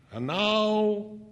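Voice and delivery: high, drawn-out showman's announcement; addressing a crowd. A narrator's reading. Narrator to Monica before the mirrors, addressing a crowd. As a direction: announce it grandly, pitched well up. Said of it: "as if I was addressing a crowd".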